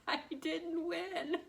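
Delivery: high, slow, laughing mock sob; mock crying whine. Recalling losing the school board seat, mock tearful. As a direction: whimper it, laughing through.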